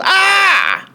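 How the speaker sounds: very high startled scream; father's shriek. A narrator's reading. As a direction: shriek from a sudden bite on the nose. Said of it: "and he yelled".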